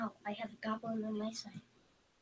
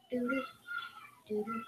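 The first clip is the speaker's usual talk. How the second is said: ordinary talk, nothing marked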